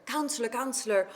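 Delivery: high, urgent cries of pain; acted king wailing over his hurt arm; pained cry. King in pain calls counselor.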